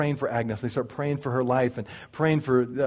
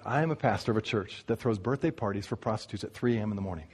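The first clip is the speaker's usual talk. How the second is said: low, soft matter-of-fact reply; calm answer; 7 semitones lower, 8 dB quieter than usual. Retold or imitated. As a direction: answer gently, lower and softer. Tony's quiet reply.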